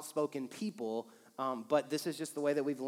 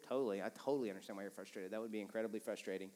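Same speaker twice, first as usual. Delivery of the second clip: soft, quick, sympathetic reply; understanding reply; 8 semitones lower, 10 dB quieter, faster than usual. Shown as a demonstration. Pastor voicing a spouse's understanding reply, soft and sympathetic. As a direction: reassure them you totally get it. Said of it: "Can you understand why I'm frustrated?".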